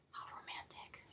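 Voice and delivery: sighed, whispered swoon over a TV scene; dreamy sigh. Retold or imitated. Fan swooning at a TV kiss, sighed whisper. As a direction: breathe it out like a lovesick fan. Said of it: "I was like".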